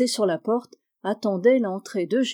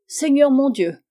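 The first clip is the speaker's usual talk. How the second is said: marmonné plus haut et plus fort, agacé; grommellement de vieille servante; 5 semitones higher, 6 dB louder than usual. Acted. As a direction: une vieille servante qui ronchonne. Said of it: "grommela la vieille femme". (la vieille servante grogne contre le cocher, agacée)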